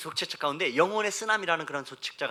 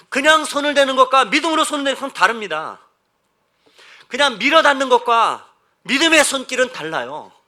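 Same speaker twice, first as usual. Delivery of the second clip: loud, raised sermon voice; forceful preaching; 7 semitones higher, 11 dB louder than usual. Acted. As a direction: proclaim it to the whole congregation. (높고 크게 선포하는 설교조)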